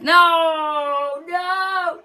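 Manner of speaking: high, loud sung-out cry of no; anguished no. Real, not acted. Gamer watching a chance, high loud wail. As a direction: wail it out, half singing.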